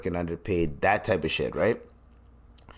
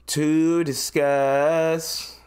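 drawn-out announcer tease; slow, higher; 6 semitones higher, much slower than usual. Pretend. Streamer drawing out his segment intro slowly. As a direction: stretch it out slowly.

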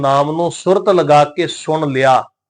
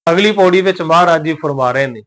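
normal talking voice

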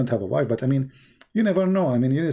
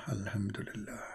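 whispered mock piety; 7 semitones lower, 16 dB quieter than usual. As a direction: breathe it out, voiceless. Mock pious aside.

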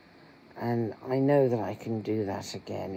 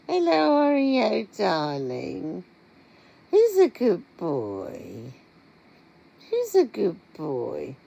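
much higher and louder cooing to a cat; pet talk; 9 semitones higher, 9 dB louder, slower than usual. Real, not acted. Greeting her cat Oreo.

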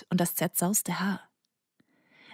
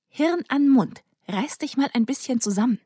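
higher, exasperated self-reproach; mock command to her own brain; self-scolding; 6 semitones higher than usual. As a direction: snap at yourself to get a grip. [name] scolds herself mid-stammer.

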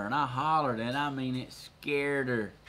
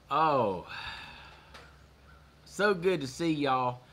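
ordinary delivery

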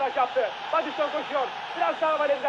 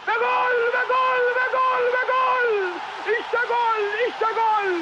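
screaming football commentator; 9 semitones higher, much slower than usual. Real, not acted. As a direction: scream "gol" over and over. Goal scored, commentator erupts. (çığlık atan maç spikeri)

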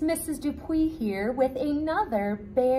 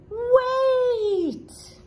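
"Wait!" cried much higher, breaking the pattern; sudden high wait; 12 semitones higher, 5 dB louder than usual. Acted. Bear breaks the refrain.

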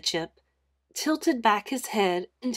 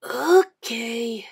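louder, with a wrinkled nose; drawn-out, put-off reaction; disgusted comment; 5 dB louder than usual. A narrator's reading. Boy commenting on John's shudder, disgusted. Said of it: "wrinkling up his nose".

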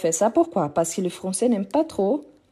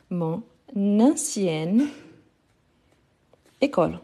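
"mon... nancienne... école", very slow with long pauses; slow breakdown; slower than usual. Shown as a demonstration. Teacher breaking the example down, very slow, word by word.

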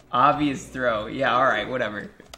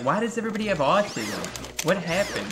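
higher, baffled mock voice; confused people of the future; bewildered future people; 6 semitones higher than usual. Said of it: "they'll be like".

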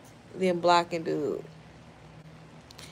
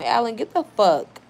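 ordinary delivery

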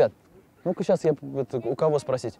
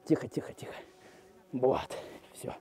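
soft unvoiced hush; whispering; 4 semitones lower, 8 dB quieter than usual. Real, not acted. Calming caught ram.

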